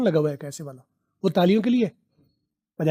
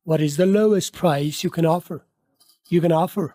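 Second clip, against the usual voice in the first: slow model sentence; much slower than usual. Shown as a demonstration. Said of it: "slow model sentence".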